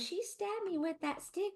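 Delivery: high, slow mock whine; hammed-up Southern whine; aggrieved husband. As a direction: complain like a wounded patient. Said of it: "And he goes".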